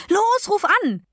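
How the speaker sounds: high-pitched excited command; urgent urging. A narrator's reading. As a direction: push them to act now, pitch leaping up. Urging mother to phone radio quiz. (aufgeregtes Drängen)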